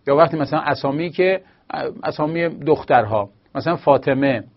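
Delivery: normal talking voice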